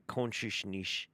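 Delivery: Consciousness slowly with slurred sh sounds; imitating his accent. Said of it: "That sounds, uh, like a northy".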